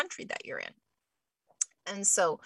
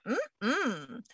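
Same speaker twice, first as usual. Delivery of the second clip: gut no hum; 3 semitones higher, 5 dB quieter than usual. Retold or imitated.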